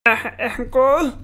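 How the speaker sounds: plain reading voice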